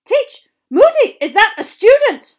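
very high, loud, horrified shriek. A narrator's reading. Professor realising the ferret is a student, shrieking. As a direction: shriek the question in alarm. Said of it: "shrieked Professor McGonagall".

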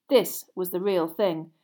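ordinary delivery

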